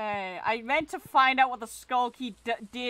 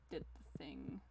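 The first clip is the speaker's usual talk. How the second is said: very low, near-whispered mumble; muttered aside; 7 semitones lower, 18 dB quieter than usual. Real, not acted. Player mumbles to self about the task, muttered aside. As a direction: let it sink away under your breath.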